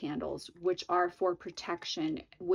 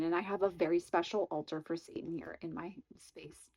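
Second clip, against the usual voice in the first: whisper; 2 semitones lower, 6 dB quieter than usual. Real, not acted. Confiding about her Satan altar.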